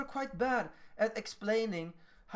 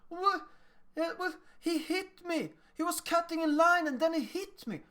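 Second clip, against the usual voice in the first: put-on high-pitched child's complaint; upset child; 8 semitones higher than usual. Child telling an adult about being hit, high-pitched complaint. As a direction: play a child haltingly telling on someone. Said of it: "the child says".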